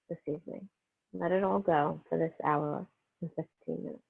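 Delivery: calm, unhurried meditation voice with long pauses; guided meditation. Real, not acted. Yoga teacher easing students into breath and healing energy, guided meditation. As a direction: settle them gently into the breath, phrase by phrase.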